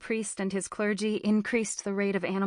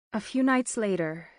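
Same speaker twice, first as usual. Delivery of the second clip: ordinary delivery, nothing marked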